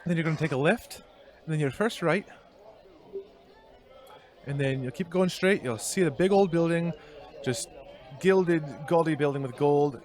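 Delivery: a light hint of a Scottish shopkeeper; Scottish woman. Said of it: "I'm going back to Scottish".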